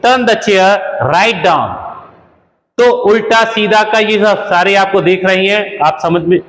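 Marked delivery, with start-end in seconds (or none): 0.1-1.7 very slow, careful teacher read; very slow example for learners; very slow model sentence. Shown as a demonstration. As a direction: dictate word by word. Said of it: "तो बोलेंगे".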